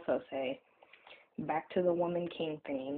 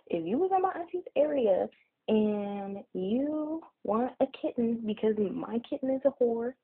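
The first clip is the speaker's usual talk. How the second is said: high, bouncy mock commercial voice; ad read; 7 semitones higher, 3 dB louder than usual. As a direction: pitch the kittens like a TV ad. Mock ad for auntie's kittens.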